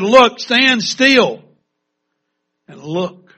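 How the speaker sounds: slow, loud, high commanding call; Moses' commanding shout. Acted. Moses to the grumbling people, a slow loud shout. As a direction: plant them in place with a big slow command. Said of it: "Moses says ... he said".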